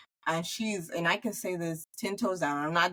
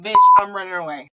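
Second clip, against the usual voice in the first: loud mock brush-off; mock quitter; 12 dB louder than usual. Imagined friend bailing out, loud mock brush-off. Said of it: "be like".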